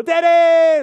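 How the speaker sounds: put-on high, loud child's cry of Daddy; excited kids shouting. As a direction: shout it like a thrilled little kid at the door. Kids running to the door.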